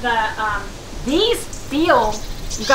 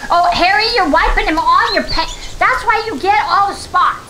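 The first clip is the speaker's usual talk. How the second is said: higher, mock-scolding wife; 6 semitones higher than usual. Real, not acted. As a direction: tease him for the stains. Scolding husband wiping hands.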